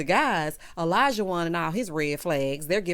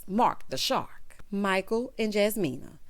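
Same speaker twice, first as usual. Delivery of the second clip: usual speaking voice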